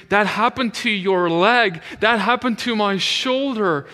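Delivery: high, loud retold exclamation; excited amazement. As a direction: exclaim it like an astonished friend. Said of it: "freaking out, like, so excited".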